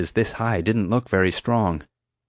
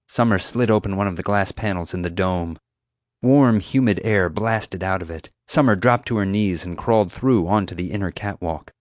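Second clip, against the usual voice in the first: usual speaking voice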